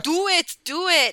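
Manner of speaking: high, loud Arnold taunt; Arnold impression. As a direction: egg him on like Arnold. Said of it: "a lot of Arnold quotes".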